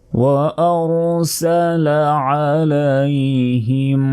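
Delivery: Quran recitation. Acted. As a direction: draw out every vowel in a chant. Recites the verse melodically.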